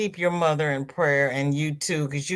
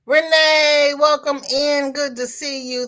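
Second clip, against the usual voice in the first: cheerful greeting; 9 semitones higher, 6 dB louder than usual. Real, not acted. Greeting a new viewer.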